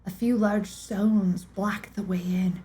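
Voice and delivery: steady, measured storyteller read; audiobook-style narration of a mystery; story narration. A narrator's reading. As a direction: draw listeners into a dark tale. Reads a dark story aloud.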